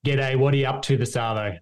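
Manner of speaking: casual Australian accent, repeated for copying; natural Australian accent demonstration. Shown as a demonstration. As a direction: say it in easy everyday Aussie. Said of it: "so say it again".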